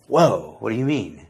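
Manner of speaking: acted startled interjection with swooping pitch; mock surprise. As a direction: play a listener taken aback. Voices a startled listener's objection.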